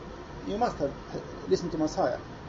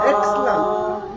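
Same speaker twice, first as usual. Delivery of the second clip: delighted praise; 2 semitones higher, 5 dB louder, much slower than usual. Real, not acted. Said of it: "praising the choir".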